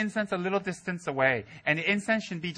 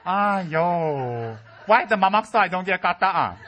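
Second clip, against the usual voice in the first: Malaysian English; 6 dB louder, slower than usual. Retold or imitated. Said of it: "Today you're like".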